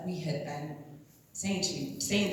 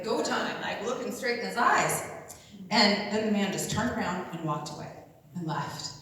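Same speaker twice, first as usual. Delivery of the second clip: ordinary talk, nothing marked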